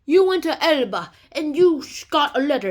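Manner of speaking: loud, forceful mock accusation; accusing guard. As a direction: lay out the charge loudly.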